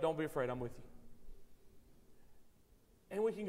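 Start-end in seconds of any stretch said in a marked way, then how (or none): 0.0-0.8 gentle reassurance. Retold or imitated. Pastor voicing the Lord's comfort.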